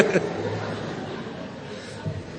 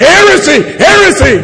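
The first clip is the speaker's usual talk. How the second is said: raised mock cry of accusation; mock outcry; 2 semitones higher than usual. Preacher mimicking accusers who cry heresy, mock outcry.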